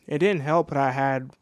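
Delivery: ordinary talk, nothing marked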